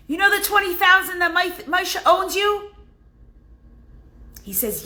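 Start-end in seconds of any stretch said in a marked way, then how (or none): 0.1-2.7 higher, louder, quick call; wife calling over to the neighbor; a high wife voice calling across. Retold or imitated.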